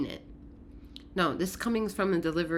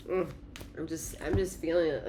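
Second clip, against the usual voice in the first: ordinary talk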